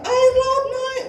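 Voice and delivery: high, loud retold crowd shout; teriakan orang panik. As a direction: imitate villagers crying out in alarm. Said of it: "Orang-orang teriak". (teriakan panik warga yang ditirukan)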